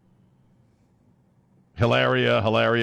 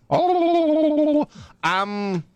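slow, higher-pitched fake Spanish accent; mock Spanish accent; 6 semitones higher, slower than usual. Host as her dodging Spanish-speaking reporters, mock Spanish accent. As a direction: stall in a hammy Spanish accent. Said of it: "speak to her in Spanish and see how she does".

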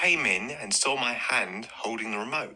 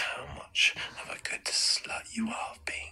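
whisper; 12 semitones higher than usual. Acted. Audio porn whisper.

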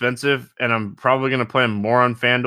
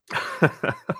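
breathy laugh; 6 dB quieter than usual. Real, not acted. Laughs at own clumsiness.

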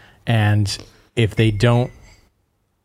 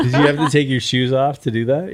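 laughing; 5 semitones higher than usual. Real, not acted. Teasing colleague on the floor.

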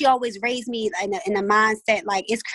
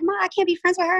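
daughter's voice; 9 semitones higher than usual. Retold or imitated. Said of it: "she was like".

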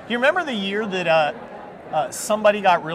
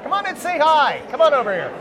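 much higher, eager beckoning call; 7 semitones higher than usual. Real, not acted. Host beckons the guest to join, high eager call. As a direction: pull them over from across the room.

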